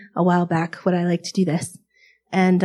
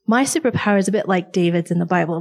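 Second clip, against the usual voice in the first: normal talking voice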